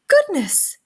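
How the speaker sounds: a shocked little shriek of goodness; alarmed cry. A narrator's reading. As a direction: burst out in sudden dismay. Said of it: "cried Mole".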